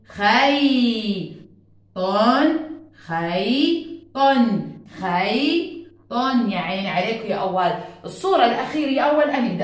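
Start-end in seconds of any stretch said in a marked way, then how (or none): 0.1-5.8 خيط drawn out very slowly; slow together. Shown as a demonstration. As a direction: lead the class saying خيط slowly. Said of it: "يلا مع بعض". (نطق جماعي بطيء)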